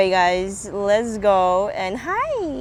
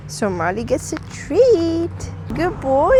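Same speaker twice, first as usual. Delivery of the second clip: high, sing-song praise for a dog; talking to a dog; 6 semitones higher than usual. Real, not acted.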